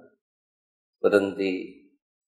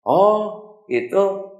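high and bright, fast at first; breezy teacher brushing it off; teacher chirping a goodbye to the boy; cheery teacher; 9 semitones higher, 5 dB louder than usual. Acted. Teacher answers, leaves boy behind.

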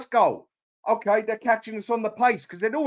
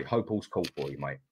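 ordinary delivery

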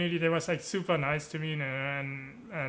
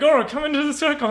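loud, high call across a dance floor; calling out invitation; 10 semitones higher, 8 dB louder than usual. Retold or imitated. Friend calls him into the dance circle, loud urging. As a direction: beckon a friend in with a raised voice.

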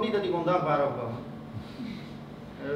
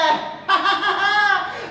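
loud, high jeering cackle; mocking laugh; 11 semitones higher, 4 dB louder than usual. Class rat jeering at Ali, mocking laugh. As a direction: laugh at him for all to hear.

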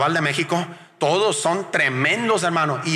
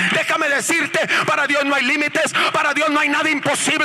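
high, shouted revival preaching; full-force preaching; 9 semitones higher than usual. Acted. Preacher urging the church to praise, shouting high. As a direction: rouse the congregation to applaud.

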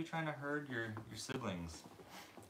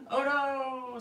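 surprised cry; 14 semitones higher, 8 dB louder than usual. Real, not acted. Puppy falls.

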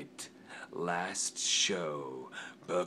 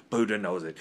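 ordinary delivery

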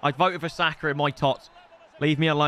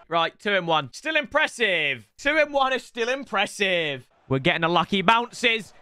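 ordinary talk

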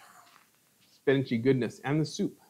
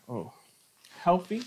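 ordinary talk, nothing marked

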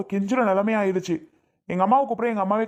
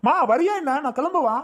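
higher-pitched, exasperated calling out; impatient yelling; 7 semitones higher than usual. Acted. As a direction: yell for mum, fed up with waiting. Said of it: "என்ன அருணே கத்திட்டு கிடக்க?". (பொறுமையிழந்து கத்தல்)

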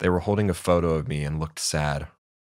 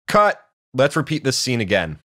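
high, loud shout easing off; calling out like a director; 6 semitones higher, 5 dB louder than usual. A narrator's reading. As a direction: call a halt on a film set. Mocking voice calls out like director.

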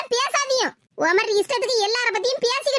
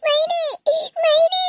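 louder, rising calls for Maini; desperate call; 7 semitones higher, 3 dB louder, much slower than usual. Acted. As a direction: cry out her name twice. (மைனியை அலறி அழைக்கும் குரல்)

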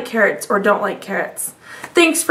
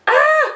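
high mock cry; 13 semitones higher than usual.

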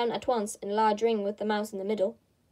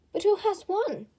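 eager question; 9 semitones higher than usual. A narrator's reading. Crowd asks panting.

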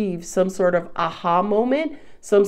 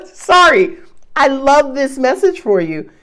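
ordinary talk, nothing marked

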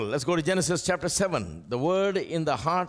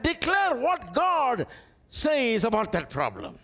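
preaching build-up; 10 semitones higher than usual. Acted. Pastor urging the church to declare God's word, rising into ringing preaching. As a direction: build from steady teaching to a ringing high declaration.

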